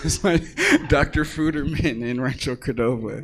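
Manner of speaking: loud, laughing through the words. Real, not acted. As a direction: say it while cracking up.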